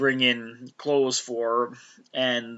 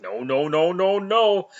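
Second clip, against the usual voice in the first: rising, louder run of no's; frantic protest; 7 semitones higher, 5 dB louder than usual. Retold or imitated. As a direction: stop them before they grow up. Wanda protesting kids aging.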